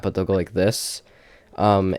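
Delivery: usual speaking voice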